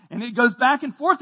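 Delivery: ordinary talk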